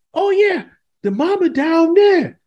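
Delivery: put-on very high, slow little girl voice; mock little girl. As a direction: answer like a carefree little girl. Girl's casual reply.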